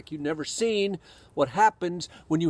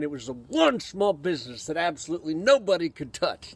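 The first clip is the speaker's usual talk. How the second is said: ordinary talk, nothing marked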